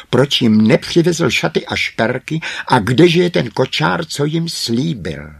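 loud, high, indignant demands; furious yelling. A narrator's reading. Greedy sisters berating their father over promised presents, furious yelling. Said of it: "jako rozkacené saně křičeli".

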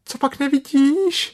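tiny animal shouting to be seen; Piglet crying out. A narrator's reading. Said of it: "křičelo prasátko". (prasátko křičí, mnohem výš)

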